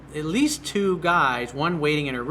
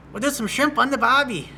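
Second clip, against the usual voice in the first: mock Australian; 10 semitones higher, 5 dB louder than usual. Shown as a demonstration. Jokey Aussie catchphrase.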